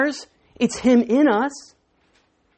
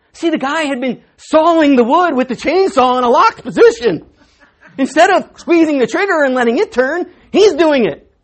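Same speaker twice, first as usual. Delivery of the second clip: laughing; 4 semitones higher, 8 dB louder than usual. Real, not acted. Laughing at the punchline.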